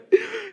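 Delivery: a loud, high laugh at her own rant; high burst of laughter. Real, not acted. Laughing at her own mock complaint, loud and high. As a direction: crack up at what she just said.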